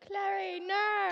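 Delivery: hammed-up Aussie "no" with R-coloured O; Australian TikTok imitation. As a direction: mimic the Australian trend line. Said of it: "that trend on TikTok".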